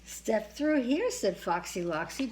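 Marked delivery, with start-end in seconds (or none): 0.1-1.1 slow, coaxing fox; sly fox voice. A narrator's reading. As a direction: lure them in slowly. Fox luring birds.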